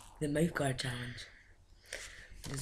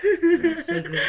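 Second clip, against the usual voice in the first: laughing; 12 semitones higher, 9 dB louder, much slower than usual. Real, not acted. Disgusted while eating.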